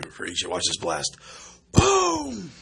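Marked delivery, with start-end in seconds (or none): 1.7-2.5 excited boom. Acted. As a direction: yell it like an explosion. Blast goes off in game.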